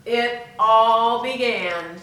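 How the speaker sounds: ordinary delivery, nothing marked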